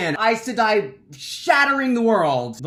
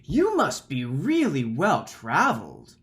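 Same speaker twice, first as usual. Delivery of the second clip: making fun; 2 semitones higher, 4 dB louder than usual. Retold or imitated. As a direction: tease them with exaggerated awe. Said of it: "I'm totally making fun of him".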